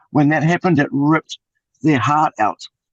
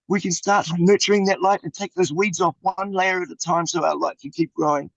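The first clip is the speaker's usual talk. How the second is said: normal talking voice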